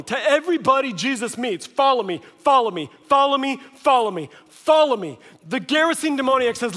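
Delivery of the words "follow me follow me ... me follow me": loud, raised repeated summons; emphatic calling. Acted. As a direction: call out each one like a preacher building force. Jesus calling everyone, rhetorical repetition.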